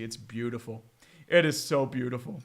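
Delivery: deadpan sarcasm about the failure; quiet deadpan irony; ironic praise. Real, not acted.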